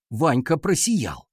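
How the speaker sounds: plain reading voice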